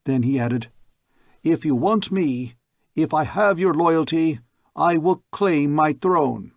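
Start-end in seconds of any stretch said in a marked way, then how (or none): 1.4-6.5 raised, louder proclamation; proclaiming to a crowd. Acted. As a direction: declare it to the people, firmly.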